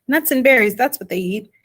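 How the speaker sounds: ordinary delivery, nothing marked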